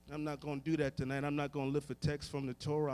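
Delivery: usual speaking voice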